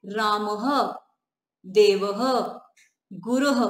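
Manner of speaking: रामः, देवः, गुरुः each ending in a short breathy h; विसर्ग के साथ रामः, देवः, गुरुः का उच्चारण; visarga demo. Shown as a demonstration. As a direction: end रामः, देवः, गुरुः with an echoed h sound. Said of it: "तो कैसे बोलेंगे इसे?".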